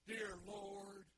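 loud, self-satisfied prayer; hammed-up pious boasting; smug Pharisee. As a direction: pray like a show-off, slowly. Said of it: "He gets up there and he says".

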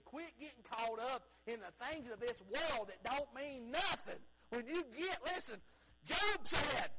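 loud, high, driving preacher's cry; rising revival preacher shout; full-force preaching. Acted. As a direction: preach it out hard to the congregation.

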